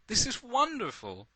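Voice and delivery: high-pitched cry of wonder; delighted cry. A narrator's reading. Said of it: "you cry".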